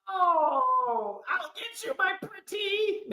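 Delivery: high cackling witch; I'll get you like the wicked witch; hammed-up witch threat. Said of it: "Wicked Witch line".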